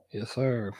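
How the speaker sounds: usual speaking voice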